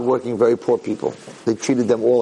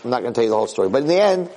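ordinary delivery, nothing marked

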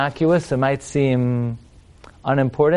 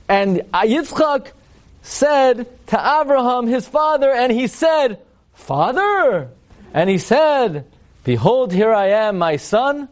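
high, ringing, stretched-out stage declamation; mock Shakespearean delivery; 7 semitones higher, slower than usual. Rabbi spoofing Isaac and Abraham's exchange, grandly Shakespearean. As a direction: play it as pompous Shakespeare. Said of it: "Shakespeare over here".

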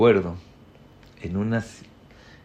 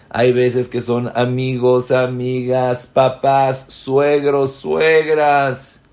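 emphatic list; 4 semitones higher, 8 dB louder, much slower than usual. Real, not acted. Enumera a quién perdonar.

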